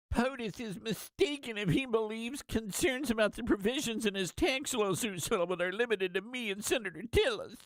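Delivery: high, prissy mock voice reading the post. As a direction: read it back like a whiny senator. Reading Cornyn's post mockingly.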